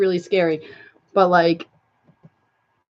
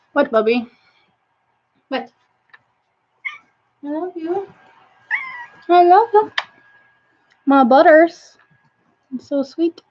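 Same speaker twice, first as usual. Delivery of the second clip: affectionate pet talk; 9 semitones higher than usual. Real, not acted. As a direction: coo lovingly at your pet, very high. Cooing to a pet.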